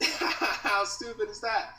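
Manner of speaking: laughing slowly at his own dumb story. Real, not acted. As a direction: let a real laugh carry the words, slow.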